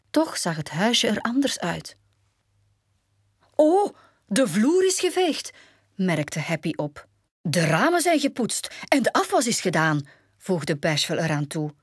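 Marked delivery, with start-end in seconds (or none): 3.5-5.5 high, loud gasp of astonishment; amazed. A narrator's reading. Happy notices the swept floor, amazed. 7.4-10.1 high, excited report; amazed. A narrator's reading. Bashful tells the dwarfs what got cleaned, amazed.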